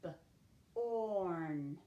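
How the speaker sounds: "B-orn" split into a short buh, then a long held orn; sounded out. Shown as a demonstration. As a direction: sound out "B-orn" with a gap after the b. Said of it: "I'm gonna dash it out".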